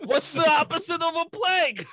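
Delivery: loud, high excited question; excited shout. Real, not acted. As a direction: blurt it out gleefully.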